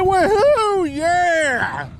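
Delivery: high happy whoop; excited cheer. Real, not acted. Celebrating the catch.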